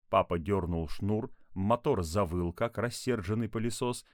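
plain reading voice

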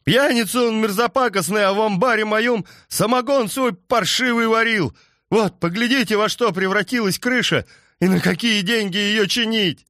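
roaring. Acted. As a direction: bellow the complaint in rage. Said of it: "взревел крестьянин".

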